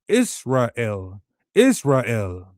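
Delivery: Israel said as Is-ra-el, three clear syllables; Greek pronunciation. Shown as a demonstration. Scholars sounding out the Hebrew name, Is-ra-el. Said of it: "sounded out in Greek".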